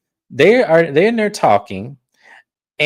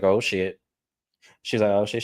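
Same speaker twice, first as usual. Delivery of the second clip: nervous; 5 semitones lower, 6 dB quieter than usual. Retold or imitated. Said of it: "Kay is looking nervous as hell".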